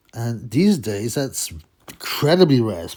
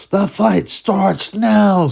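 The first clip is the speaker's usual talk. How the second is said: slow, loud, high announcer boom; announcer catchphrase; 9 semitones higher, 9 dB louder, much slower than usual. Retold or imitated. Quoting a ring announcer's catchphrase, slow booming voice. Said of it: "little catchphrase".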